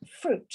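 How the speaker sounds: FRUIT as one syllable, high and falling; pronunciation demo. Shown as a demonstration. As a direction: model FRUIT for learners, pitched high. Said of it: "esto se pronuncia así".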